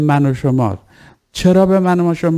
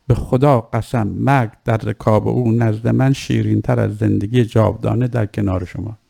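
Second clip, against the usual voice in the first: put-on measured, lightly lowered recital of an old text; formal maqtal reading; 3 semitones lower than usual. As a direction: recite the ancient speech with solemn weight. Reading the Shimr and Zuhair exchange. (خوانش رسمی و آرام مقتل)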